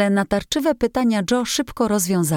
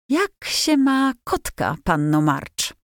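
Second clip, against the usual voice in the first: put-on a boy keeping a straight face, higher and slow; mock-serious politeness; 7 semitones higher, slower than usual. A narrator's reading. Laurie teasing Jo with mock formality, straight-faced. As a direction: hold back a laugh, play it formal. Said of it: "próbując zachować powagę".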